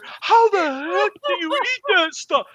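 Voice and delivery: shocked friend. Retold or imitated. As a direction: gasp it out after the burn. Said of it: "downing water".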